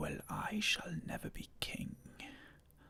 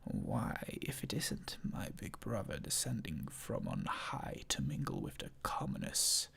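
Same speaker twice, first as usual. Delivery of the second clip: mild sarcastic whisper; 6 dB louder than usual. Acted. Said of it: "sarcastically overjoyed".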